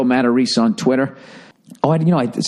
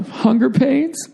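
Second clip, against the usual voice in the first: high; 13 semitones higher, much slower than usual. Pretend. Joking punchline.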